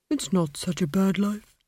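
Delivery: put-on a put-upon old tree, grumbling; sulky talking tree with a grudge; aggrieved tree voice. A narrator's reading. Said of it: "thought the tree".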